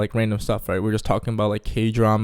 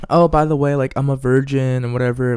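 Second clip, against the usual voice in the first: mock girl's voice, pitched up; imitating the girl; 3 semitones higher, 4 dB louder than usual. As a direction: put on the girl's casual confession. Voicing the girl's confession.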